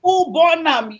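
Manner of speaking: Nigerian Pidgin quote. Retold or imitated. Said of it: "Lynette said".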